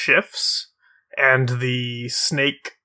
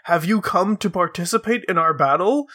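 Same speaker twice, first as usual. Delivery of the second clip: high, genial challenge; high snake man voice; 6 semitones higher than usual. Acted. Snake man welcoming the travellers to battle, high and genial. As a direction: invite them to battle in a higher voice. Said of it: "they respond".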